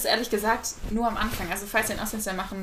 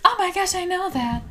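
excited; 10 semitones higher, 5 dB louder than usual. Retold or imitated. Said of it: "she was like".

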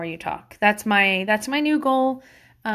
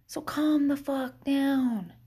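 slow, soft calm-down; 3 semitones higher, 5 dB quieter, much slower than usual. Real, not acted. Telling anxious parents to relax.

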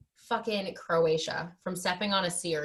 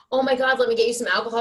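fast and louder, a touch high; mock frantic ex; 4 semitones higher, 6 dB louder, faster than usual. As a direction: rush it like a panicky boyfriend. Imitating ex panicking.

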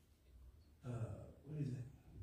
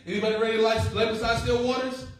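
preaching call; 8 semitones higher, 10 dB louder than usual. Real, not acted. Preacher calling out to congregation.

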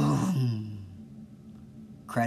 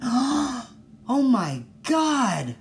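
much higher, louder, very slow stretched squeal; mock ecstatic gasp; 12 semitones higher, 6 dB louder, much slower than usual. Podcaster mocking how people react to an amazing gift with a squeal. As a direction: overdo the delight like a gift reveal. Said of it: "jump for joy".

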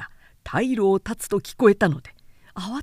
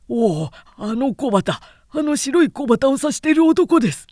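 higher, louder excited cry; excited pointing out; 5 semitones higher, 5 dB louder than usual. Acted. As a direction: point across the river, eager and alarmed. Soldier pointing out the enemy general.

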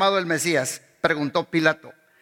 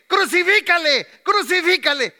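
high and louder mob cry; roaring mob shout; 9 semitones higher, 3 dB louder than usual. Retold or imitated. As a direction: yell it like an angry crowd demanding death. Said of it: "rugió más fuerte".

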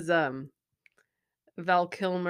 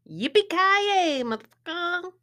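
high, loud, drawn-out whoop; whooping catchphrase; 12 semitones higher, 5 dB louder, slower than usual. Acted. Repeating the catchphrase as a hint for the guesser, whooped high. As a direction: holler it out and stretch the yay.

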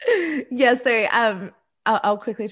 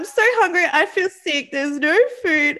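put-on much higher, louder whine of a starving girl; mock whiny hungry; 9 semitones higher, 5 dB louder than usual. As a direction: whimper like you're about to cry from hunger. Whiny hungry self-quote.

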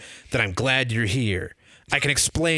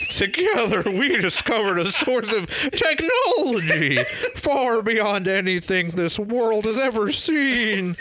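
high, slow theatrical scientist; dramatic professor; 8 semitones higher, slower than usual. Acted. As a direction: ham up the wronged genius. GM plays the professor.

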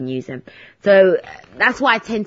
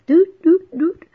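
high sung doot beeps; tracker beep noise; 10 semitones higher, 4 dB louder, slower than usual. Retold or imitated. Mocking the pizza tracker app with high beeping doots. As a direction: mimic the app's beeping tracker. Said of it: "So annoying".

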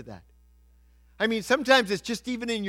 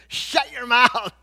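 slow, raised retold scolding; mock rebuke; 6 semitones higher, slower than usual. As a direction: stretch out a comic telling-off. Acting out crowd rebuking the beggar.

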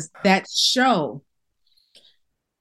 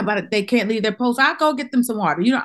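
ordinary talk

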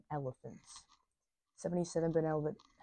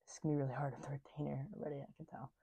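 whispering; 5 dB quieter, much faster than usual. Real, not acted. Aside about the retainer.

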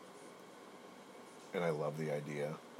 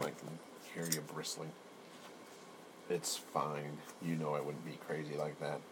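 ordinary delivery

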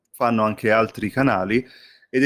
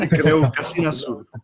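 laughing; 8 semitones higher, 5 dB louder than usual. Real, not acted. Laughing after joke about Neapolitan.

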